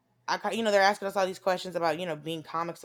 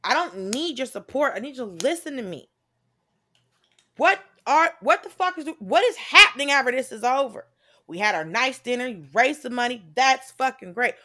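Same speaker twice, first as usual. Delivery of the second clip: heated rant; 5 semitones higher, 5 dB louder than usual. Retold or imitated. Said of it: "I was like".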